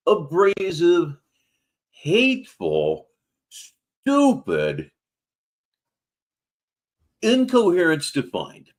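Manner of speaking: spaced-out, deliberate insult list; slow emphatic insults. Real, not acted. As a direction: spit out each insult slowly. Host insults streamer word by word.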